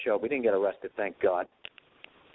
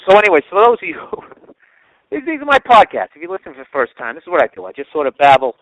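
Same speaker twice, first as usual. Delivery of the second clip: loud laughing aside; 4 semitones higher, 15 dB louder than usual. Real, not acted. Podcast aside, laughing, much louder.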